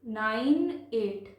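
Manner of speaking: "Nine एट" very slow, each digit separate; teacher's drawn-out demo of "nine एट". Shown as a demonstration. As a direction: stretch "nine", then place "एट" on its own. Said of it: "पढ़ते हैं".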